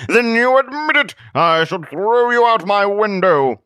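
high, loud triumphant threat; paranoid ranting hermit. Acted. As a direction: crow it out as a paranoid recluse.

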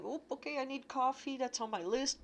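light, high inner monologue; chirpy grocery-list self-talk. Retold or imitated.

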